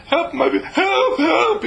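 high, shrill mock panic with a laugh; laughing mock screech for help. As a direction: shriek help in a hammed-up panic. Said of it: "you preach and you screech".

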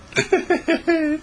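put-on very high-pitched child's giggle; high, impish titter; mischievous giggle. Howie giggles at his mischief.

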